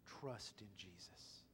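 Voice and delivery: slow, hushed and tender; gentle slow reassurance; slow soft. Acted. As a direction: let it settle slowly, softly. Quoting Jesus in prayer.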